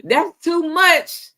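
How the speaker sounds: high, slow mock complaint; mock holler. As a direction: imitate her squawking at the price. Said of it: "No wonder you hollering about".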